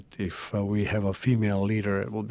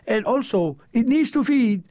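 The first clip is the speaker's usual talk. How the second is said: Georgian accent, high, slow chanted poem; 12 semitones higher, 6 dB louder, slower than usual. Shown as a demonstration. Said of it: "I should read it with my Georgian, with my Georgian, uh, accent".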